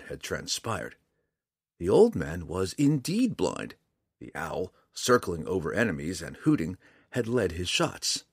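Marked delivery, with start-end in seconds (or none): none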